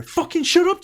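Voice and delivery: very high, loud scornful snap; mock snapping wife. Mocking Rachel snapping at James for complimenting her, high and loud. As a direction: bite his head off mockingly. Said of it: "And you go".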